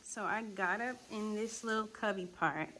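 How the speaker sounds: normal talking voice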